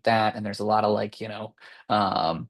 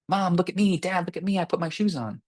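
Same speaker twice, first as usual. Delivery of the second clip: put-on much higher, eager kid calling for attention; attention-seeking kid; 9 semitones higher than usual. Child calling parents to look at their shoes, high and eager. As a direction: chirp like a kid showing off. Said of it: "all of a sudden they went".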